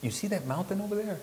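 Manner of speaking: high, quick villager voice; breezy high-pitched guide, rattled off fast; imitated villager. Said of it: "the person would say".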